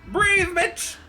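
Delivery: high loud yell. Real, not acted. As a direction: shout it at the screen. Yelling at the video.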